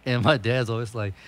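normal talking voice

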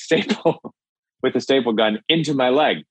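ordinary talk, nothing marked

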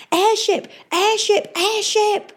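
shouting alarm. Acted. Crewman warning the town of attack, alarmed shout. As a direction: raise the alarm at the top of the lungs. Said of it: "someone was shouting".

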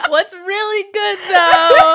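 laughing swagger line; laughing bro greeting. Retold or imitated. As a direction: crack up while saying it. Continuing teacher greeting laughing.

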